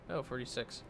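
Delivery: ordinary delivery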